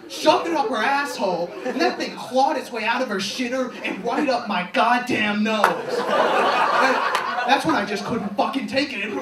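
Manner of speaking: crude story shouted high and very loud; yelling. Retold or imitated. Shouting gross stories at a campus tour group, loud. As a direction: bellow it so the whole tour group hears. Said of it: "started yelling".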